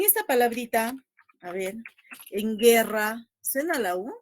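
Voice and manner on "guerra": teacher shows "guerra" with the U left silent. Shown as a demonstration. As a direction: say "guerra" as ge-rra, no U sound. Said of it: "No suena, ¿verdad?". ("guerra" sin que suene la U)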